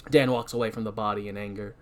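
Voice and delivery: normal talking voice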